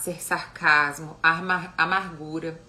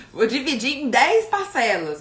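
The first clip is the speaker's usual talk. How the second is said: laughing; 6 semitones higher, 8 dB louder, slower than usual. Real, not acted. Joking about anger paid in installments.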